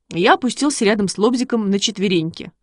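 usual speaking voice

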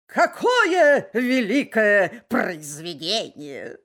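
slow, loud, puffed-up bark; pompous turkey bark. Acted. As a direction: bark it out slowly, swollen with pride. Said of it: "и гаркнул". (важный индюк, гаркает)

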